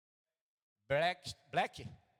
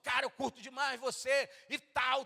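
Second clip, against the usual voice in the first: euphoric fan; 9 semitones higher, 3 dB louder than usual. Retold or imitated. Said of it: "todo eufórico".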